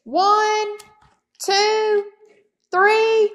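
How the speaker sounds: big, loud sing-song bear count; booming bear. Acted. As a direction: boom it out playfully like a huge bear. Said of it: "Boris boomed".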